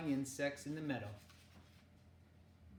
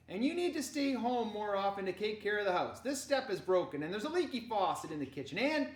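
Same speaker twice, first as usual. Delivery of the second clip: raised, loud list of complaints; playful nagging; 6 semitones higher, 5 dB louder than usual. Acted. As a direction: nag your brother, building up to the last word. Sister playfully nagging brother.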